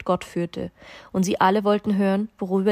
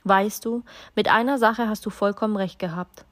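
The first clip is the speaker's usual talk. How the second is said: usual speaking voice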